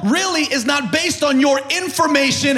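high, full-throated preaching shout; real fired-up pulpit climax; excited preaching. Real, not acted. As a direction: build to a sustained high cry. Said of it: "I get a little excited".

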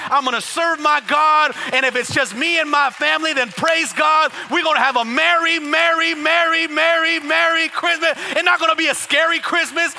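high, loud, exultant shouting; rally the church with a sustained shout; fired-up declaration building to a call for amen; full-force preaching. Real, not acted.

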